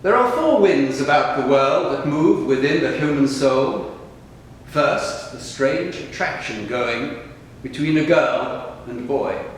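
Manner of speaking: solemn, spacious verse delivery; poetry recitation. Acted. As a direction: declaim each line deliberately, letting the phrases hang. Reads own poems aloud after the talk.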